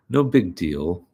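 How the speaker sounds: usual speaking voice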